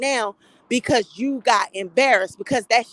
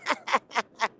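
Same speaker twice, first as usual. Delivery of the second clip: real softer run of chuckles; amused laugh; 6 dB quieter than usual. Real, not acted. After retelling the colonic threat, amused laughter.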